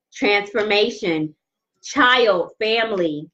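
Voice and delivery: ordinary talk, nothing marked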